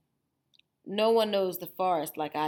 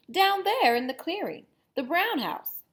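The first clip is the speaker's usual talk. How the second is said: put-on high girlish voice; Little Red voice; 9 semitones higher, 4 dB louder than usual. Little Red showing the wolf Granny's house, high girlish voice. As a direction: point the way like a little girl.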